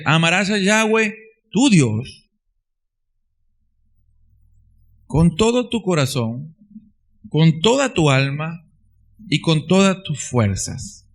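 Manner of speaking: solemn reading of the verse; scripture reading. Acted. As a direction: proclaim the great commandment. Quoting the commandment.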